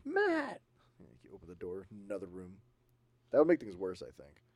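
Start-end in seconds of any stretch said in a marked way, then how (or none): 0.0-0.6 high, drawn-out call for her son; mock mom calling from afar. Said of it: "your mom's like".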